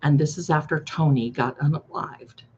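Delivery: normal talking voice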